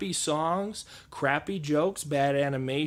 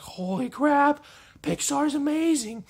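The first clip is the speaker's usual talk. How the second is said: very high, loud, slow gasp of wonder; amazed; 12 semitones higher, 4 dB louder, slower than usual. Retold or imitated. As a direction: squeal it like your jaw hit the floor. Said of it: "just like".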